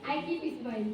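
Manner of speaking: low, very soft mumble; playing the grinning pupil; soft mock student line. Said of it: "फिर भी हंस रहा है". (धीमी आवाज़ में शरारती बच्चा)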